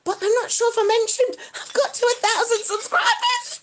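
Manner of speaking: high, giddy announcement; too excitable. Real, not acted. Streamer announcing a subscriber milestone, excitable. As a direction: burst out with the big news. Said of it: "getting a little bit too excitable".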